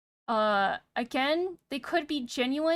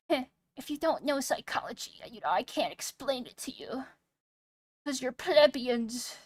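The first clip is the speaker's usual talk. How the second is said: joking condescension. Pretend. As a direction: play the snob talking down to the ignorant. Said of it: "that was me joking".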